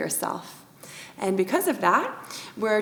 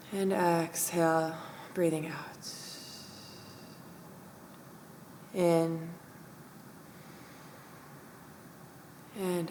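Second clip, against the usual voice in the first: guided meditation; 5 dB quieter than usual. Acted. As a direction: guide the breath in and out, calm and unhurried. Leads breath meditation.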